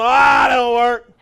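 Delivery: loud, slow dismissive scoff; mock dismissive uncle. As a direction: shut it down. Negative uncle quoted.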